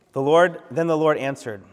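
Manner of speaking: usual speaking voice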